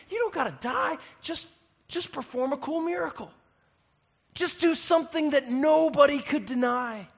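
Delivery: high, loud, eager coaxing voice; a devil hyping up a cheap miracle. Acted. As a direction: sell the stunt like a slick tempter. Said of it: "Satan's like".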